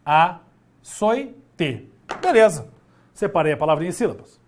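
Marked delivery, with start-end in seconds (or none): 0.0-1.9 açoite broken into a, soi, te with pauses; syllable by syllable. Shown as a demonstration. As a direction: slowly separate açoite into its three syllables. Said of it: "Se eu separar em sílabas".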